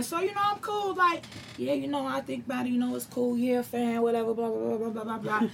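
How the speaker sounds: quiet, mumbled mock of a laid-back guy; mocking Brandon. She mocks her easygoing husband, quiet and lazy. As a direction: lazily brush it off, soft and trailing. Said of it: "He don't wanna talk to Brandon".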